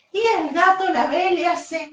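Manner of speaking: put-on bright, lively voice reading to little children; storytelling for kids. A narrator's reading. Teacher telling kids the baby bird story, animated storyteller. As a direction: tell it like a bedtime tale for a class.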